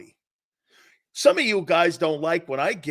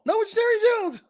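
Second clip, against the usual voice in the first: much higher, fast squeaky puppet voice; ventriloquist puppet voice. Retold or imitated. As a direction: play Romo as a dummy on Jerry's lap. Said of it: "you talking or Jerry?".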